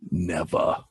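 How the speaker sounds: ordinary delivery, nothing marked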